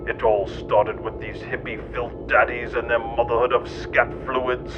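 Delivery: ordinary delivery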